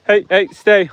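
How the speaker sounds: normal talking voice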